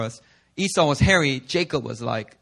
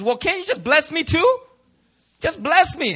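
pleading Esau; 10 semitones higher than usual. Retold or imitated. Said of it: "Esau starts to cry and says".

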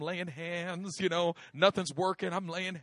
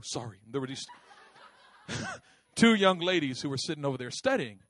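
ordinary delivery

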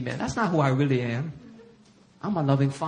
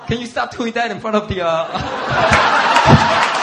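higher, mildly exasperated plea; wife's mock scolding; 6 semitones higher, 9 dB louder, much slower than usual. Wife scolding husband, mock exasperation. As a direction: scold him with comic exasperation. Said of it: "she'll yell at me".

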